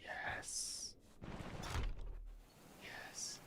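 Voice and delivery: barely voiced whisper to a pet. Real, not acted. Streamer holding the dog close, hushed whisper.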